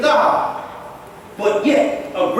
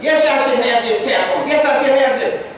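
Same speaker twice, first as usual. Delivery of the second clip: normal talking voice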